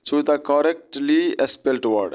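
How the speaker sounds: reading out the instruction slowly. Shown as a demonstration. Teacher reads the exam question slowly to students. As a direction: read the exam line slowly, word by word. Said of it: "ऐसा ही लिखा रहता है".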